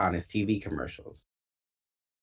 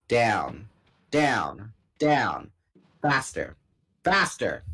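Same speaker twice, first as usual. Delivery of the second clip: rising louder and higher; building excitement; 3 semitones higher, 4 dB louder, much slower than usual. A narrator's reading. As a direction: build the speed with each word. Plunging dive, building excitement.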